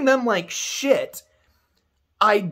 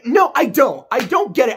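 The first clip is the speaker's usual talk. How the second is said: loud, higher burst of real frustration; sudden emphatic outburst; 6 semitones higher, 5 dB louder than usual. Real, not acted. Annoyed at the scene he is describing, a real loud no. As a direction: shout down his own sentence. Catches himself, rejects his statement.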